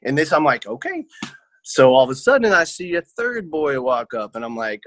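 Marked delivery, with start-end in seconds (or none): 0.7-1.0 a high, soft, squeaky okay; startled okay. Retold or imitated. Seeing a man get up with a stick, a high startled okay. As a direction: let it slip out small and alarmed.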